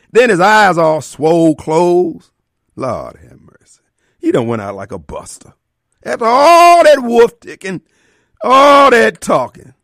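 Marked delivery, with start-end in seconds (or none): 6.0-9.7 mocking scorn. Mocking friend's bravado.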